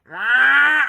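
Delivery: loud high squawking cry; bird squawk. Retold or imitated. Mimicking the water hens at his door, a loud high squawk. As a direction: screech like a hungry water hen. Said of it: "they go".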